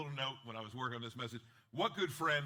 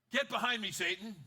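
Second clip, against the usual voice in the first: higher, louder commanding rebuke; Jesus rebuking Peter, loud and stern; 8 semitones higher, 7 dB louder than usual. Retold or imitated. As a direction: cut him down hard.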